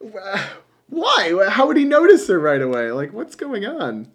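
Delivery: high-pitched incredulous questioning; baffled viewer, voice jumping up high; mock bafflement. Acting out baffled viewer's reaction.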